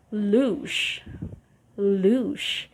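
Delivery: louche, said LOOSH, one syllable; model word. Shown as a demonstration. Teacher modelling louche, a single LOOSH. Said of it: "you say".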